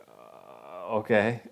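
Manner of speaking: lost for words, drag out the uh before okay; imitating a dumbfounded, halting reply; stunned hesitation. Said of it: "didn't know what to say".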